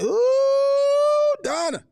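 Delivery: playful oh-no-she-didn't; mock scandalized ooh. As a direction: gasp over the juicy list, half joking. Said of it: "I was like".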